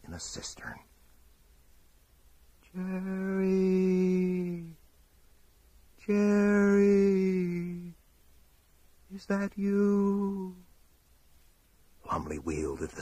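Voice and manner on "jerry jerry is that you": high, drawn-out searching call; long-held, high-pitched holler. Acted. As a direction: call across a great distance, slow and high. Calling out through the storm.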